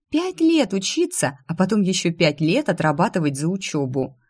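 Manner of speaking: ordinary delivery, nothing marked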